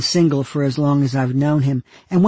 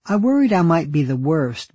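usual speaking voice

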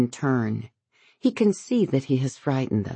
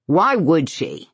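belligerent; 3 semitones higher, 4 dB louder, slower than usual. A narrator's reading. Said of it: "he sounds belligerent".

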